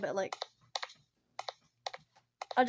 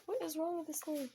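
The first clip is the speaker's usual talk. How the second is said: ordinary delivery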